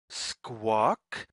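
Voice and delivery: ordinary delivery, nothing marked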